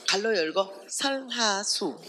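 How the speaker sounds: drawn out slowly, savouring "설화수"; slow deliberate. Acted. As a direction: spell out the brand with relish. Writing brand name pointedly. ("설화수"를 천천히 또박또박)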